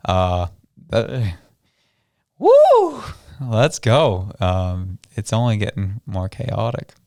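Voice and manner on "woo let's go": high, loud sudden "woo"; excited whoop. Real, not acted. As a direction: let out a thrilled cheer. Cheers about chaos.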